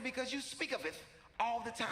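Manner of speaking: high, formal bougie son explaining; Hassan. Retold or imitated. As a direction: answer primly, imitating an upper-crust kid. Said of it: "He said".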